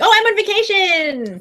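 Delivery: high, slow, gleeful announcement; excited. Real, not acted. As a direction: savour the word vacation.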